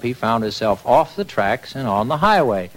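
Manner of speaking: usual speaking voice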